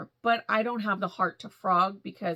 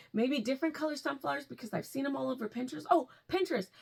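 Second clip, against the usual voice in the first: ordinary talk, nothing marked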